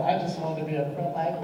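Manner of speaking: normal talking voice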